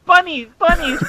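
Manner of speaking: high-pitched, giggly squeal of the words; giggly mock quote. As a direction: squeak it through rising laughter. Mock-quoting what he started writing.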